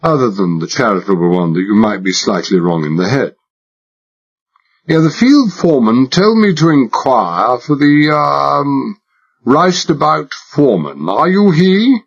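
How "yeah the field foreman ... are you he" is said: put-on high-class English accent laid on thick, higher; hammed-up upper-class English drawl; exaggerated British accent. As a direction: play the posh Englishman to the hilt. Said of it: "I exaggerated it".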